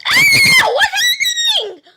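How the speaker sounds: very high squealed scream; play-acted panic. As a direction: shriek in mock alarm. Panicked cry in pretend fight.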